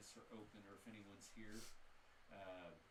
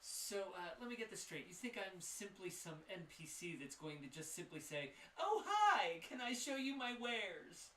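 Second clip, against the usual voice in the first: high, loud, theatrical shopkeeper; sassy shopkeeper; 7 semitones higher, 8 dB louder than usual. Acted. Shopkeeper scoffs at the party for treating him like an NPC, high and loud. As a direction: show off, then mock the idea of being a simple merchant.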